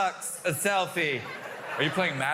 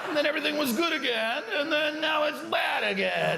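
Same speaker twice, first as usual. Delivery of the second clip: high, strained comic complaint; hammed-up frustrated recap; mock exasperation; 7 semitones higher, faster than usual. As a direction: plead with a heckler in raised pitch.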